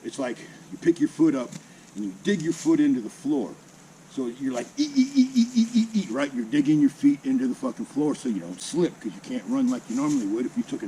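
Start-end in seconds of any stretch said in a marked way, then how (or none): 4.8-6.1 short high, loud squeaks in a row; high squeaky imitated noise. Recruit digging his feet in to run in shower slides, high squeaks. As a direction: mimic each careful step with a squeaky "ee".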